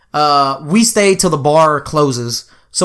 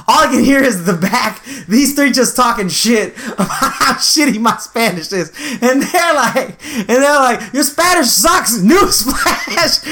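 high-pitched telling through laughter; laughing; 7 semitones higher than usual. Real, not acted. Telling how friends mocked his Spanish, laughing. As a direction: keep cracking up mid-sentence.

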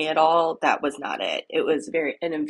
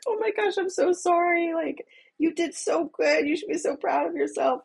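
comforting mom; 11 semitones higher than usual. Retold or imitated. Mom comforts upset child.

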